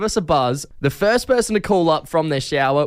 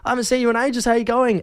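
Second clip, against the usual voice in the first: excited catch-up; 8 semitones higher, faster than usual. Retold or imitated. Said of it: "It's like".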